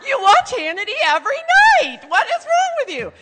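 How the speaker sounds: loud, high hammed-up outburst; exasperated. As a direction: blow up at a stubborn parent, overplayed. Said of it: "I wasn't really that exasperated".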